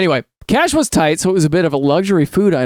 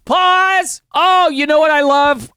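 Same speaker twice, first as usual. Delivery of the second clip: excited; 11 semitones higher, slower than usual. Real, not acted. Excited interruption of reading.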